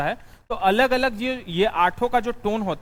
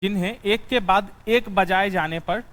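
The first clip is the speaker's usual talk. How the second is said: dictating notes. Acted.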